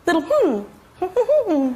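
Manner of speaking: slow, drawn-out tiny hum; terse little hmm. Shown as a demonstration. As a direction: hum it as the laconic child. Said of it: "small phonemes".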